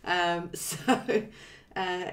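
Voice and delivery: ordinary talk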